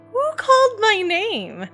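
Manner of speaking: high, drawn-out wondering question. A narrator's reading. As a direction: slowly wonder aloud, pitched well up. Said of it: "I sat there wondering".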